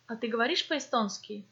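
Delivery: ordinary talk, nothing marked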